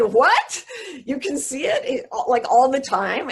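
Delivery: shocked and half laughing; astonished disbelief. Retold or imitated. Mother reacting to her son who sees the light, astonished and laughing.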